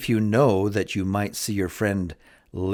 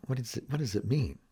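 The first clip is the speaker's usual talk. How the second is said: puzzled muttering aside; 9 dB quieter, faster than usual. Retold or imitated. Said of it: "you might wonder".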